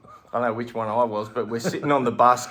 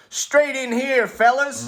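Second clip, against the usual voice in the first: much higher, louder, booming welcome; welcoming bouncer; 13 semitones higher, 7 dB louder, slower than usual. Retold or imitated. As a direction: wave them in with big cheer. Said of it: "and he goes".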